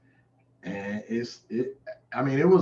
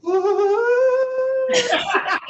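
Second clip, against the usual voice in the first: very high, loud burst of laughter; 8 dB louder than usual. Real, not acted. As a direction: cackle at his own joke. Laughing at own joke.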